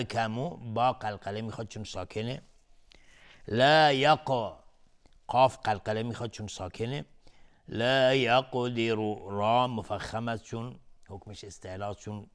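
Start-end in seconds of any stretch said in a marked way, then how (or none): 3.5-4.5 slow, melodic, drawn-out chant; Quran recitation. Shown as a demonstration. Reciter chanting the whole verse slowly, melodic and devout. Demonstrates qalqala. 7.7-8.9 slow, melodic, drawn-out chant; Quran recitation. Shown as a demonstration. Reciter chanting the whole verse slowly, melodic and devout.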